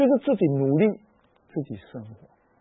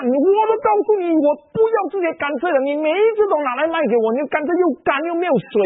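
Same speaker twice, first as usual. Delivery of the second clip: scolding vendor; 10 semitones higher than usual. Retold or imitated. Juice vendor scolding the old woman, shrill angry yelling. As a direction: rail at her like a fed-up stall owner. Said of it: "就开始骂这个老人家哦".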